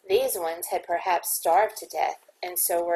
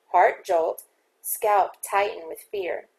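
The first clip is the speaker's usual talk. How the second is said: usual speaking voice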